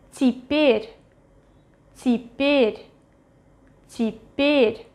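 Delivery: Теперь with the first е as i, stress on the second; reduced unstressed Ye. Shown as a demonstration. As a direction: say теперь as ti-PYER, modelled for learners. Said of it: "pronounced as И".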